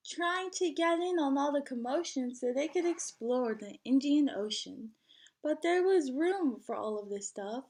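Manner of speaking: lively storybook read-aloud; singsong picture book reading; reading to children. Acted. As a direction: read it like story time for a class. Teacher reads picture book aloud.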